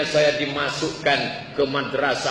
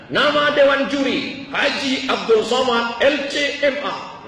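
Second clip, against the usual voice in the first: pembawa acara; 9 semitones higher than usual. Retold or imitated. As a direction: call the name out ceremoniously. (suara pembawa acara memanggil nama juri)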